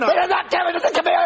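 high, exasperated shout; indignant customer yelling down the phone; outraged. Acted. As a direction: call out the obvious fake, voice shooting up. Customer outraged at the fake supervisor.

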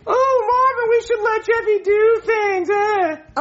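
very high falsetto woman; shrieky wife impression. Shown as a demonstration. Puppeteer imitating the girlfriend to replace her, high falsetto. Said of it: "I'm gonna do Marvin's wife or girlfriend".

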